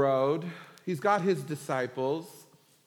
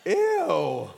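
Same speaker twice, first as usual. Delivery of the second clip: loud, higher-pitched hammed-up "ew"; mock disgust; 5 semitones higher, 8 dB louder than usual. The ten to the favor-seeking brothers, disgusted. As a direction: blurt it out, thoroughly grossed out.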